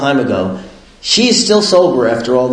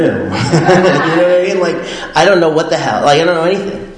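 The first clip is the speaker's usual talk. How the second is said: ordinary talk, nothing marked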